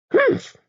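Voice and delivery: sharp high snort of disdain; scornful humph. A narrator's reading. Dame Tabitha brushing off Max's remark, scornful humph. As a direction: snort it off dismissively.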